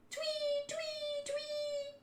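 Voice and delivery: very high, soft "twee"; thin high-pitched tweeting; imitated whistle. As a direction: squeak out whistle blasts.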